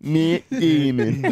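very slow, drawn-out mock voice; Matt Damon. Host echoes the name-only impression, slow put-on voice. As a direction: stretch the name out dopily. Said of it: "Matt just quietly in the back".

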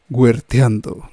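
deep, loud announcer voice; radio voice. Pretend. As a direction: boom it like a radio jingle. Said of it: "podemos jugar aquí un poquito con los micrófonos diciendo".

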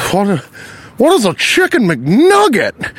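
high, slow mock bafflement; hammed-up old man puzzling over the menu; bewildered customer. Line-cutter baffled by the menu, mock bewildered voice.

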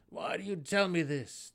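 loud angry growl. A narrator's reading. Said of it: "the Svirfneblin growled".

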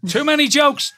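high, incredulous exclamation at normal speed; high-pitched protest. Real, not acted. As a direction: squeal at the innuendo. Co-host amused by the innuendo.

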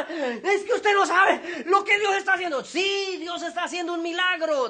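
high, loud, breakneck excitement; frantic joy. Retold or imitated. Father tells the pastor of the miracle, frantic excitement. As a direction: shout astounding news over all objections.

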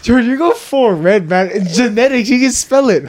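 high laughing teasing. Real, not acted. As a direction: rib him with a laugh. Teasing blushing friend.

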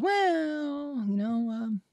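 high, stretched "well"; hedging doctor. Retold or imitated. Doctor avoiding the word cancer, hedging. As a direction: dodge it in a high, wavering stall.

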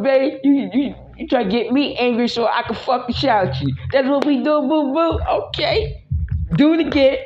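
high, teasing taunt; singsong taunting boo boo; playful taunting. Pretend. Playful taunting of partner, high pitched.

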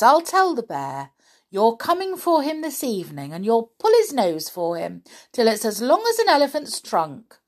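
doll pretending on telephone. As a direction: agree knowingly with an imagined caller.

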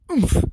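high loud oomph as he lands; squashed grunt from the bush. A narrator's reading. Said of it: "It said".

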